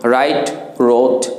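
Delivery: usual speaking voice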